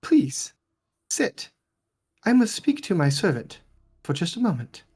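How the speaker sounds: lightly voiced polite noblewoman; gracious lady. Lady Wachter excusing herself to the guest, lightly voiced. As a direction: excuse yourself with a touch of grace. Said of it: "she's like".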